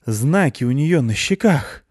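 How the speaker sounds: slow, high, gurgling breathy line; slow gurgle on the breath; gurgling exhale. Acted. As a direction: push it out through a throat full of liquid. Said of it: "выдохнула с бульканьем".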